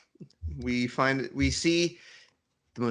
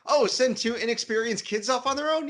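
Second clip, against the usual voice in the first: sarcastic; 11 semitones higher than usual. Acted. Sarcastic quote of characters.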